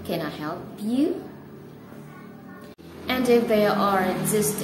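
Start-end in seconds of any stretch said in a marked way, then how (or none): 0.0-1.2 "you" glides sharply up at the end; rising intonation. Shown as a demonstration. Teacher giving a second yes-no question example, rising intonation on "you". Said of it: "rising intonation in the end".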